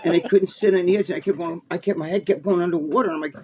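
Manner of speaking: ordinary talk, nothing marked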